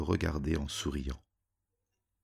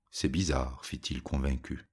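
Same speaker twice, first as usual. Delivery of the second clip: usual speaking voice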